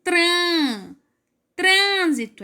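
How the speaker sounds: TRÂN isolated high, then leading trânsito; stressed syllable. Shown as a demonstration. Teacher isolating the strong syllable trân, stressed. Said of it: "A sílaba mais forte é o trân".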